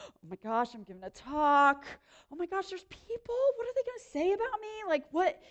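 rising, anxious self-talk; imitating her own nervous panic; mock panic. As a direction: spiral up into a mock freak-out. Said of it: "Literally the whole day I was like".

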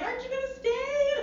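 lightly voiced sweet mom, high; high-pitched mom voice. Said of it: "her being like".

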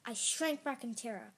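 ordinary delivery, nothing marked

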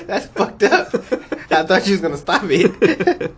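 high laughing words. Real, not acted. Host laughs at cohost's creepy intro, high laughing. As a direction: talk through real laughter.

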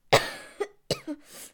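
real dry coughing; coughing fit. Real, not acted. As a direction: cough hard mid-sentence. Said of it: "sorry I'm coughing so much".

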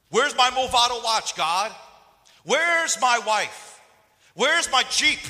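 high, full-volume mock yelling; belted, indignant questions; entitled demanding. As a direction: shout demands at God like a spoiled believer. Acted entitled demands shouted at God.

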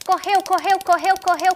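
high, urgent running chant; high-pitched patter of fleeing; breakneck repetition. A narrator's reading. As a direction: chase the words along in a high voice. Acting out the frantic running.

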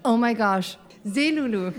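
ordinary delivery

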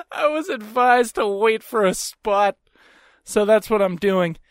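slow retort through laughter; amused smug retort. Retold or imitated. Man relishes his comeback to woman, laughing and smug. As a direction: repeat the comeback slowly, tickled by it.

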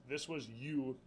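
ordinary delivery

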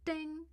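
high, ringing voiced "ding"; phone notification ding. A narrator's reading. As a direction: imitate a phone alert chime. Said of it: "Leah's cell dinged with a flurry".

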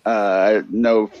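ordinary talk, nothing marked

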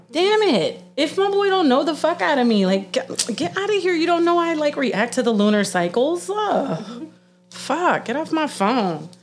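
high-pitched mock outrage; worked-up, comic indignation; exasperated outburst. Mock outrage at friend's warning text.

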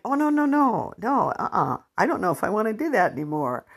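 high, fast flustered no, no; flustered refusal. Retold or imitated. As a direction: rush out a squeaky protest. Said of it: "I'm like".

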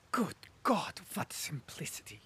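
angry whisper, dropping away at the end; whispered anger at a fool. Acted. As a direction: hiss it under his breath in disgust. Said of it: "said he angrily".

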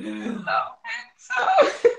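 laughing through a quoted greeting. Retold or imitated. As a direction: giggle out the line. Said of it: "I wrote that too".

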